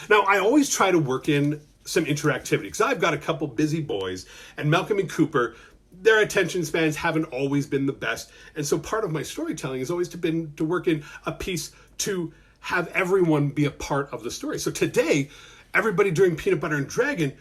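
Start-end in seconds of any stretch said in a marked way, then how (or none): none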